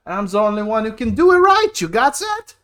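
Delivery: loud, fast, rising heated demand; worked-up insistence. Acted. Brother challenging his friend, worked up and loud. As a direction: build up to a high, loud challenge.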